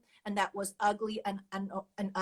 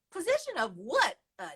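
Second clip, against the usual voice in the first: laughing retelling; 10 semitones higher, 9 dB louder than usual. Retold or imitated. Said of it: "And he goes".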